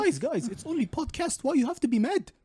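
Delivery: high, whiny mock plea; hammed-up peacemaker whine; mock pleading.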